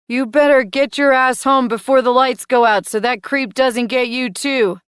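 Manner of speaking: loud, high stern dad warning repeated; same daddy voice. Acted. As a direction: parrot the scolding again word for word. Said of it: "Same manner, same tone".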